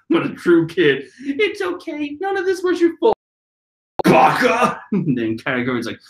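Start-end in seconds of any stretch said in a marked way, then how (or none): 1.2-4.8 put-on child's voice. Brulee voiced consoling her brother.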